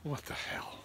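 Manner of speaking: whispered, stunned; shocked whisper. Retold or imitated. Traveler reacting to customs news, shocked whisper. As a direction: breathe it out in disbelief. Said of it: "I was like".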